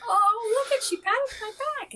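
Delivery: very high, quick mock awe; hammed-up squeal of delight; imitated excited kids. Imitating excited students noticing a pat.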